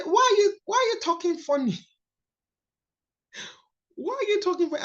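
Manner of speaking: mock the new girl in a high kid voice; teasing schoolfriends. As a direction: schoolfriends teasing her accent, high and mocking. Friends mocking her new accent.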